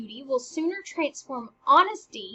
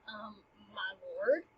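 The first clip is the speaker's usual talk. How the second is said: confused; 5 semitones lower, 9 dB quieter, slower than usual. Retold or imitated. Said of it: "she's like".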